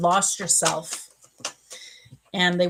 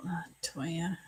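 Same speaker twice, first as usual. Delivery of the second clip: a soft whispered name, meant for one viewer; whispered aside; 12 dB quieter than usual. Real, not acted. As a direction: lean in and whisper her name. Private aside to commenter on stream.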